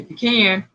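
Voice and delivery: "pecan" said pee-CAN, rhyming with man. Shown as a demonstration. Host showing the disputed way, pee-CAN. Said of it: "it is not a pecan".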